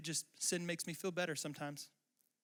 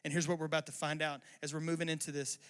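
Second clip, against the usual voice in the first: usual speaking voice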